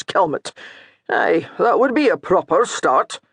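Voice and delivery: a hint of a stern, teasing cleric; lightly voiced scolding priest. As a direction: needle him about his sins. Priest lecturing the steward.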